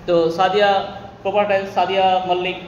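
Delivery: ordinary talk, nothing marked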